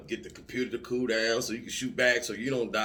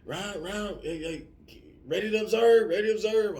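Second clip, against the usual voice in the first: much higher, louder urgent radio call; radio yelling; 10 semitones higher, 7 dB louder than usual. Retold or imitated. Forward observer yelling over the radio, loud high call. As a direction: shout it over the radio net. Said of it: "on the radio yelling at you".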